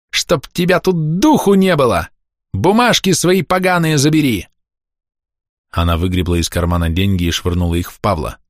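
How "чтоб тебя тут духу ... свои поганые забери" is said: high, shrieked banishment; furious scolding. A narrator's reading. Old woman throws Pavel's money back, furious scolding. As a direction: drive him out with high-pitched fury.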